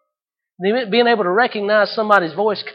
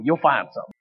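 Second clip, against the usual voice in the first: usual speaking voice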